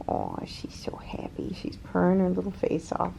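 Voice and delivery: soft tender whisper to a pet; cooing whisper. Real, not acted. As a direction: whisper fondly over the cat. Cooing at the purring cat.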